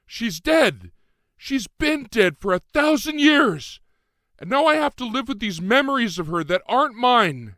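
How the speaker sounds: very high, loud tearful cry, slowing down; shouting in tears. A narrator's reading. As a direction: wail it out as the tears break through. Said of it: "I was suddenly in tears".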